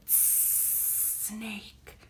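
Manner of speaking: whispered, long sibilant "Snake"; hissing snake whisper. Pretend.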